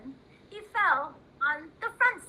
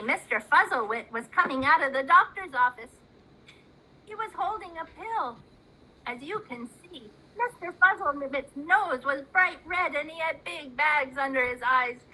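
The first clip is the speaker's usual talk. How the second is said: usual speaking voice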